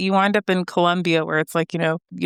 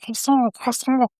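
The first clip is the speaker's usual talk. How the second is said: higher-pitched imitation of an excited toddler; excited little kid; 6 semitones higher, slower than usual. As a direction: mimic a little one thrilled to say a French word. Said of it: "he was just so excited".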